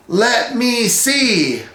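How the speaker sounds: high, drawn-out imitation of a yell; mock yell. Recapping how a contestant yelled like his dad, mock yell. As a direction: stretch it out like a yell, without full volume. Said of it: "and he yells".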